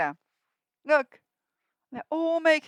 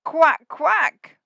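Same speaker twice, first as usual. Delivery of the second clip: loud quacking; duck quack; 4 semitones higher, 8 dB louder than usual. Acted. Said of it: "said the horse".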